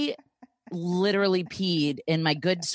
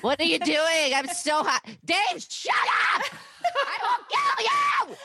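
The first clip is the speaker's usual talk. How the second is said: frantic, much higher-pitched impression; Meredith; 10 semitones higher than usual. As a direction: send up your co-host as hysterically stressed and excited. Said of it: "you're gonna answer as Meredith".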